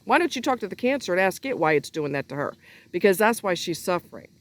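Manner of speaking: normal talking voice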